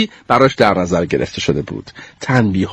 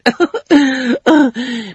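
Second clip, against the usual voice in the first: high mocking snicker; a scornful little laugh; smirk laugh; 11 semitones higher than usual. Goldie smirks at Sneeze's chicken, mocking snicker. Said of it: "پوزخندی زد".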